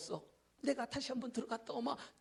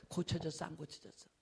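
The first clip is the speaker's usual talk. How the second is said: ordinary talk, nothing marked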